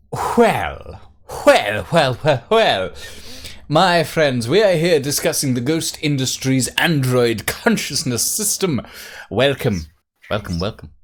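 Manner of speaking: exaggerated British host welcome; real British. Streamer welcoming friends to the lore, hammed-up British. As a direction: overdo the English accent, like a grand presenter. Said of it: "You just talk real British".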